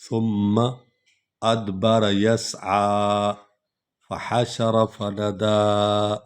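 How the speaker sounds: slow, chanted Quran recitation; slow melodic recitation; tajweed recitation. Shown as a demonstration. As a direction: intone each verse slowly, phrase repeated. Recites the passage again.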